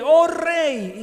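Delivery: loud, high joyful cry; effusive glory voice. A narrator's reading. Daniel answering the king from the den, loud and joyful. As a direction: exult as you greet the king. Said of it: "una voz efusiva viendo la misericordia".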